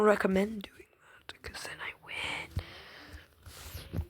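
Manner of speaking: soft breathy whisper; conspiratorial whisper. Acted. Teasing her friend Skeleton in a whispered aside. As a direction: let them in on a secret, whispered.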